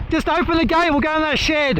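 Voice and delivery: high urgent pleading; urgent plea. Real, not acted. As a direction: hurry them to open the gate. Urging someone in hailstorm.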